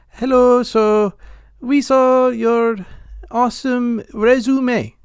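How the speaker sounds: high, loud mock Indian telemarketer accent. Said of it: "eight different accents".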